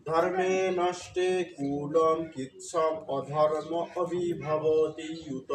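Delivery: slow, careful Sanskrit recitation; slow verse recitation. Shown as a demonstration. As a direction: chant the shloka slowly, word by word. (ধীরে সংস্কৃত শ্লোক পাঠ)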